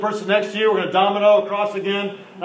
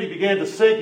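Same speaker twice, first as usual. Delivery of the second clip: usual speaking voice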